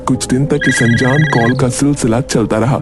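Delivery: ordinary delivery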